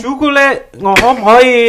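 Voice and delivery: showing off a Cantonese phrase; hammed-up Cantonese. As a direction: ham up the Cantonese for laughs.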